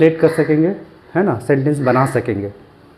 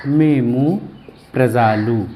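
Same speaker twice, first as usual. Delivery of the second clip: MEMU rising, a short pause, then प्रजालु lower; split for dictation; 3 semitones lower, much slower than usual. Shown as a demonstration. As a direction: give MEMU and प्रजालु separately, unhurried. वही शब्द अलग करके दोहराना. (MEMU, फिर रुककर प्रजालु, आराम से)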